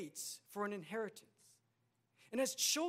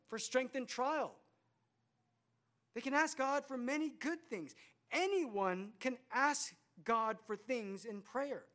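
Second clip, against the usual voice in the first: forceful preaching; 4 semitones higher, 4 dB louder than usual. Acted. Preaching builds to full force.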